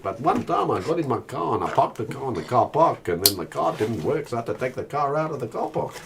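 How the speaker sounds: put-on South African accent. Telling a pointless car park story, South African accent. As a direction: lightly mimic a Tony Greig South African voice. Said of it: "Tony Greig style".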